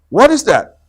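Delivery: high, loud frightened question; fearful cry. Acted. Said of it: "Rabbit cried fearfully".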